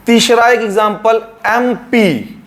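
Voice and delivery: normal talking voice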